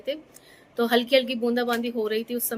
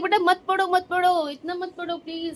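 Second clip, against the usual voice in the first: higher-pitched mock plea to a child; retold pleading; 8 semitones higher, 3 dB louder than usual. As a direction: playfully beg the kid to stop. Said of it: "हम लोग कहते हैं".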